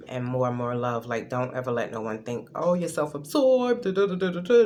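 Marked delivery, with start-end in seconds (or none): 2.5-4.6 sneering, singsong put-down; mock a judgmental critic. Mocking someone's judgment.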